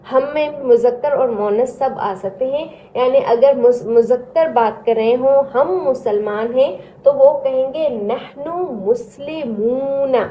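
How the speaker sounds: normal talking voice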